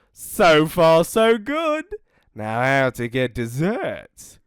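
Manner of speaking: lightly voiced smug wolf; pleased with himself; mildly smug dingo; sly dingo.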